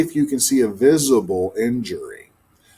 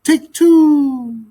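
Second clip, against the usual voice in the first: high, falling coo; cooing dove; 12 semitones higher, 5 dB louder, much slower than usual. Acted. Silly dove cooing at the magpie. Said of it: "the dove repeated".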